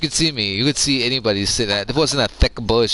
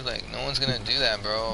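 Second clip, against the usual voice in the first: ordinary delivery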